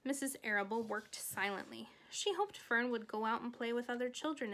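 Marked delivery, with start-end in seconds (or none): none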